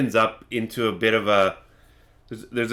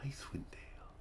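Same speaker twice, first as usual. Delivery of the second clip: barely audible whisper to yourself; hushed, trance-like wondering; musing whisper; 4 semitones higher, 24 dB quieter than usual. Acted. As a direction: whisper it, lost in memory. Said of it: "keeps talking like this".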